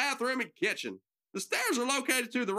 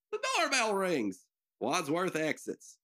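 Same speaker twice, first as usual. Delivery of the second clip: ordinary talk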